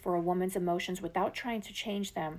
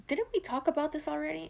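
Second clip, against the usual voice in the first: much higher and fast, fed up; exasperated; 8 semitones higher, faster than usual. Acted. Man thinking while partner vents again, exasperated and fast. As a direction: toss it off like you've heard it before. Imagined exasperated inner thought.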